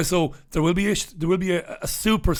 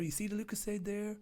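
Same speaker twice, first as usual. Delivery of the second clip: much softer, almost under the breath; quiet aside about the drink; hushed; 3 semitones higher, 18 dB quieter, faster than usual. Real, not acted. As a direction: murmur it close as if confiding. Showing drink, sudden hush.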